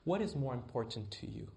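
a hushed, patient question to Jonathan; God's voice, quiet. Retold or imitated. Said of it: "this is what I heard God say".

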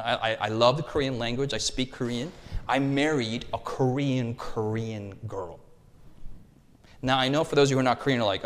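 ordinary talk, nothing marked

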